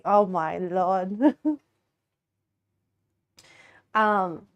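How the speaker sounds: normal talking voice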